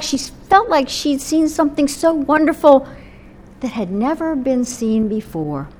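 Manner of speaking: normal talking voice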